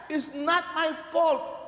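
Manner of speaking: drawn-out excuse. Retold or imitated. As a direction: stretch out the excuse. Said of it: "people say that".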